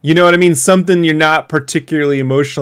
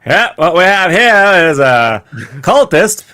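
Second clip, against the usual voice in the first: put-on high, overdone character accent; role player's hammy accent; 4 semitones higher than usual. Park actor warning parent about cultists, high hammy accent. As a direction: overact it, stretching the opening words. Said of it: "then you do the accent".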